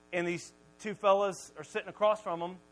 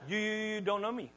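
stuck on the first word; mock stammer before the words come out; stutter; 3 semitones higher than usual. Moses protesting to God, stuttering. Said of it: "Moses had a stutter".

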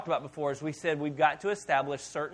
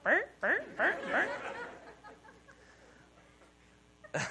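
much higher laughing bang, bang; ringtone imitation; 5 dB quieter, slower than usual. Pastor imagining his fan noise as a ringtone, higher and laughing. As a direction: squeak it out like a silly ringtone. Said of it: "somebody's ringtones".

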